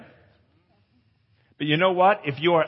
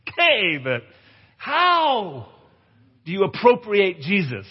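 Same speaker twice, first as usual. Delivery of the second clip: mock bewildered asking, pitch rising high; puzzled question; 6 semitones higher, slower than usual. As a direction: act out a listener who doesn't get it. Voicing a puzzled listener.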